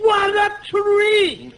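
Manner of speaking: slow, drawn-out exclaim; excited shout. Acted. Costello bragging to Abbott about his huge tree.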